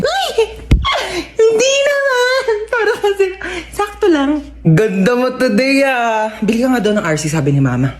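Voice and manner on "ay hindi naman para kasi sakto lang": high squealing hammed-up protest; mock flustered denial. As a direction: squeal it like you're flattered but pretending not to be. Sibling flattered, coy denial.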